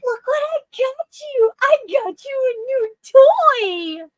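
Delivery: put-on squeaky high baby talk. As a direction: coo it like talking to a puppy. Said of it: "and say".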